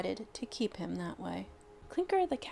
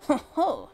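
high, loud gleeful witch cackle; old witch cackling at the tiny bear; 7 semitones higher, 8 dB louder than usual. A narrator's reading. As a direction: cackle in triumph at finding him. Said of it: "she cackled".

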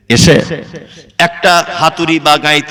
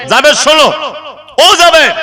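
high, raised call by name; 10 semitones higher than usual. Acted. The Prophet calling after Jaber heading home, high raised call. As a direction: call after someone to stop and listen.

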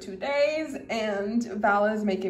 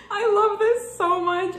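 high loud laughing cry; real delight; joyful cry; 11 semitones higher, 7 dB louder than usual. Real, not acted. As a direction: squeal it out.

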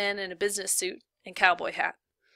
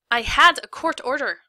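indignant retort; 6 semitones higher, 10 dB louder than usual. Acted. Lawyer argues with warden.

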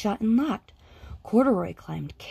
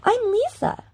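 put-on high, eager child's voice; a small girl begging her mother; little girl voice; 4 dB louder than usual. A narrator's reading. Little girl pleading with her mother, high and eager.

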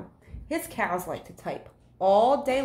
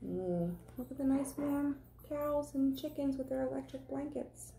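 much softer, higher cooing aside; 6 semitones higher, 11 dB quieter than usual. Real, not acted. Teacher showing the class the picture, soft cooing. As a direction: coo softly at the picture.

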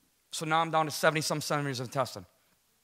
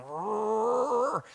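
high mock roar; a playful growl of the gut; intestine roar; 5 semitones higher than usual. Intestines coming alive after the prayer, mock roar. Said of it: "my intestines going".

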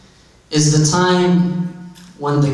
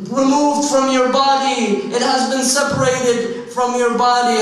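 higher, insistent mourning plea; impassioned lament; 8 semitones higher than usual. Retold or imitated. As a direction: call out to the slain beloved in grief. Mourner addresses slain Hussein.

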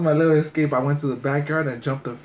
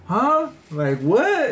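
high, loud mock-innocent "huh?"; caught-out kid feigning surprise; startled innocence; 9 semitones higher, 4 dB louder, much slower than usual. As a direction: play dumb, voice jumping up. Caught out while high, playing innocent.

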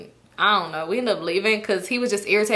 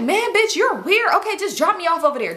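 higher annoyed friend; 8 semitones higher than usual. Retold or imitated. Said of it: "So she like".

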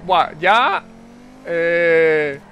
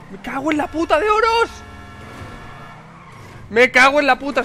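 angry swearing shout; 6 semitones higher than usual. Real, not acted. Gamer cursing his own crash, angry shout. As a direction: blow up at the missed ramp. Missed the jump in race.

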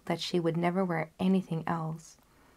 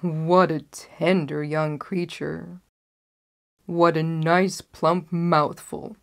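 wolf's thoughts; 4 dB louder than usual. Acted. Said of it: "The wolf thought to himself".

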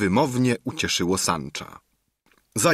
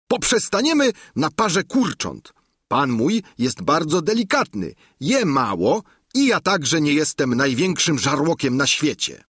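higher, simple-folk squire voice; Sancho's voice; 7 semitones higher, 3 dB louder than usual. A narrator's reading. Sancho modestly ordering supper, higher simple voice. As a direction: play Sancho as an earnest country squire.

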